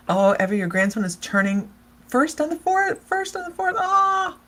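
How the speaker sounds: high, gushing mock grandma; a thrilled grandma announcing news; excited grandma. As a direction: gush like a proud grandmother. Acted excited grandma caller.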